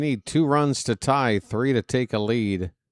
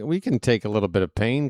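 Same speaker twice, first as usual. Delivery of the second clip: ordinary talk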